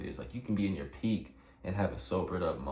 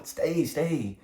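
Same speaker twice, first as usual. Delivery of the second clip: pleading cry; 8 semitones higher, 7 dB louder than usual. Retold or imitated. Begging friend not to let go.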